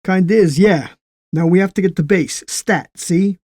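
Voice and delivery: an impatient soldier in a hurry; pushy American GI. Acted. Will presses.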